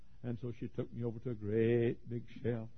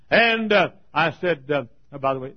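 loud and high, rushing, then a ringing question; preaching at full force; 3 semitones higher, 17 dB louder than usual. Acted. Preacher to his congregation, asking about the ringed men, preaching loudly. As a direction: cry it out over the crowd.

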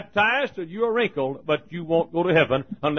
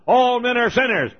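thundering demo; 6 semitones higher, 3 dB louder than usual. Shown as a demonstration. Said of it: "You got to thunder it out".

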